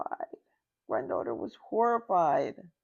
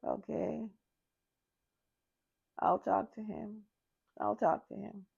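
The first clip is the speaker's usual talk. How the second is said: resigned dad; 3 semitones higher, 8 dB quieter than usual. Retold or imitated. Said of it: "So Dad is like".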